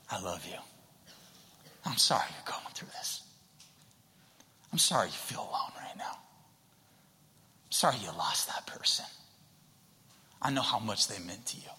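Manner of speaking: soft, half-whispered comfort with long pauses; tender consoling. Acted. Jesus with an arm around the hurting, tender and hushed. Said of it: "putting his arm around us going".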